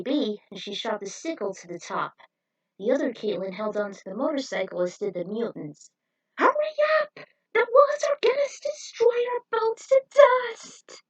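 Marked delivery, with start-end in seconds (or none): 6.4-11.0 very high, loud frantic cry; frantic scream. Acted. Teammate screaming at the others to hurry, terrified. Escaping crushing walls.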